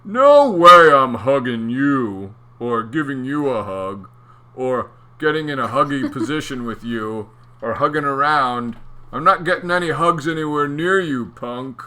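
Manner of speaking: mean eel refusing a hug; snide refusal. Acted. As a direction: brush him off rudely.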